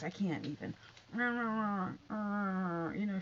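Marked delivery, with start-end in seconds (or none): 1.1-3.2 lightly mocking imitation of her speech; mimicking her talk. Vlogger showing viewers how she talks, mock mumbled impression. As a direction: mimic a woman's unintelligible drawl. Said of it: "she talks like this".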